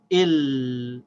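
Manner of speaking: IL with the l held long; dragged lam. Shown as a demonstration. Teacher showing how the lam connects to alif, dragged out. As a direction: drag out the l in IL. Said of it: "by dragging the sound of the lam".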